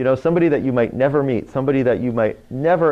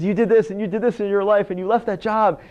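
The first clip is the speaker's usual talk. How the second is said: higher-pitched imitation of a gushing fan; excited recognition; 9 semitones higher than usual. Fan recounts the host's life to him, acted excitement.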